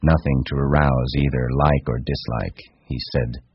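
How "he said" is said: usual speaking voice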